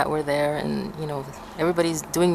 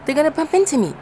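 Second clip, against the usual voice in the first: frightened; 10 semitones higher, faster than usual. Retold or imitated. Schoolgirl on the slope, scared of being hit. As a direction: fret that someone will crash into you. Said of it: "I'm like".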